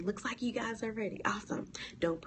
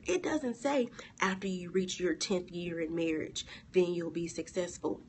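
normal talking voice

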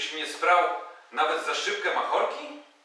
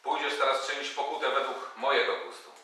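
ordinary delivery

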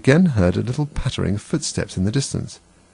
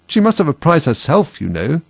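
high insistent; 7 semitones higher, 4 dB louder than usual. A narrator's reading. Mouse objecting in story.

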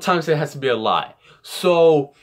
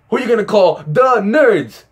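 higher, louder punchline; catchphrase call-out; 6 semitones higher, 4 dB louder than usual. Acted. Joking answer to a monster question, catchphrase call-out. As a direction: belt out a parody movie slogan.

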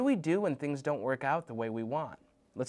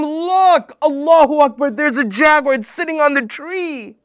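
much higher and louder, bursting with wonder; thrilled; 10 semitones higher, 15 dB louder than usual. Acted. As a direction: point it out in awe. Mom thrilled by jaguar.

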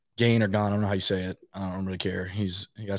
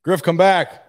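calling out; 8 semitones higher, 8 dB louder, much slower than usual. Real, not acted. Calling after departing guest.

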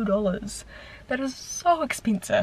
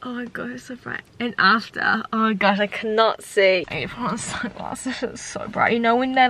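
ordinary talk

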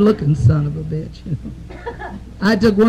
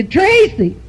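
called out high across the room; calling out; 11 semitones higher than usual. Retold or imitated. Truck driver hollers across the whorehouse to Tracy, called out high.

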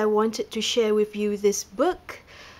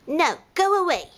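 higher snappy brush-off; rude ostrich; 7 semitones higher than usual. A narrator's reading. Ostrich rudely refuses panda's donut, higher and snappy. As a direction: shoo him off rudely. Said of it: "Quite rude".

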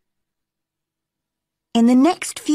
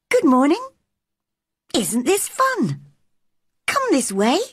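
high, playful bird voice; a cheeky little bird calling her along; chirpy robin; 7 semitones higher than usual. A narrator's reading. The robin invites Mary along the wall, high and chirpy.

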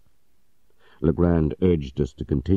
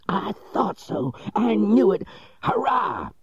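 much higher, gleeful outburst; triumphant cry. Acted. Legrand realising the mistake, high triumphant cry. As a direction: crow with triumph. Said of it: "cried Legrand".

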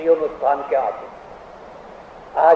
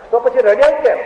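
surprised question; 7 semitones higher, 6 dB louder than usual. Retold or imitated. First take of question.

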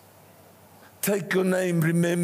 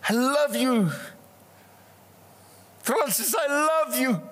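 raised, high-pitched calling; Jesus shouting; 4 semitones higher than usual. Acted. Jesus calls to a congregant by name, calling out. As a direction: call the name out high, then declare love. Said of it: "Hear how Jesus shouts it".

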